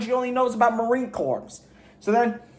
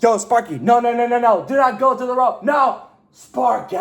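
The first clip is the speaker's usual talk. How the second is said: frantic shout, pitched up, calling the dog back; desperate shouting at dog; 3 semitones higher, 3 dB louder than usual. Retold or imitated. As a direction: yell after the dog to stop it. Begging dog to stop, acted.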